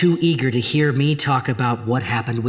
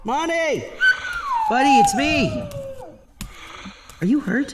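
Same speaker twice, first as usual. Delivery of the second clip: high alarmed calling; worried calling. Acted. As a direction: call out scared for the dog.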